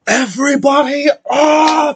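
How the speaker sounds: a very high, loud bellow; bellowed call. Acted. Father waking everyone, bellowing as loud as he can. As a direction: rouse the whole camp at the top of his voice. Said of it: "calls out as loud as he can".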